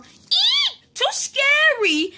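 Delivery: high squealed pretend scare; mock fright. Becca pretends fright at the roar.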